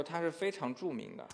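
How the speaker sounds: normal talking voice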